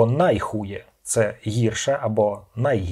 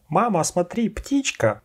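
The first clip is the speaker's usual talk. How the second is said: put-on child calling out; 8 semitones higher, slower than usual. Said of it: "ваша дитина буде кричати".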